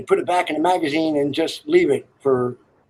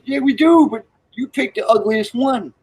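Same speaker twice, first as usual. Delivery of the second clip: voiced Southern boy; 8 semitones higher than usual. Telling a joke, hammed-up high Southern boy. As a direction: ask like a rowdy Southern boy.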